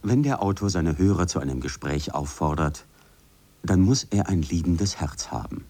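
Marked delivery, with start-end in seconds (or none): none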